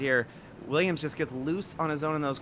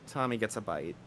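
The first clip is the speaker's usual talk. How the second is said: much softer crash call; subdued repeat; 3 semitones lower, 7 dB quieter than usual. Real, not acted. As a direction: let the call sink down quietly. Same call repeated, dropped down.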